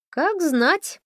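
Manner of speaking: высоко и насмешливо; иронично откликнуться. A narrator's reading. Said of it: "иронично откликнулась Тесса". (высокая ироничная реплика)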